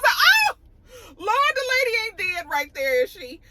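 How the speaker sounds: high, crying-out shock. Retold or imitated. Viewer shocked as grandmother passes out, high crying alarm. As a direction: gasp that the lady is dead. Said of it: "I said".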